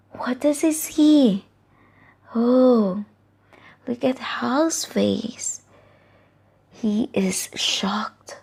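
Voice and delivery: slow hushed whisper; suspenseful whisper. Acted. Storyteller whispering suspense to the listening children. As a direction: draw the children in, slow and breathless. Suspense before Hal's shock.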